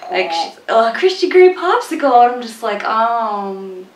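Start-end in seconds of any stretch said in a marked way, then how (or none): 0.7-2.2 swooning heroine. Retold or imitated. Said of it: "That is a line in the first book". 2.8-3.9 much softer, quick, dry sarcasm; deadpan put-down. Real, not acted. Her sarcastic reply to the heroine.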